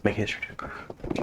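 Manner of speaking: soft, hushed whisper; whispered aside. Real, not acted. Game master asking a player for a check, whispered aside. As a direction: slip it in under your breath.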